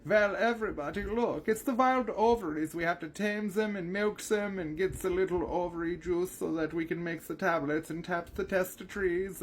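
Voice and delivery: very high put-on accented voice; Mika. Explaining the wild ovaries to everybody, high accented character voice. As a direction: pitch it way up in a foreign-accented character voice. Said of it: "Bex kinda named the voice".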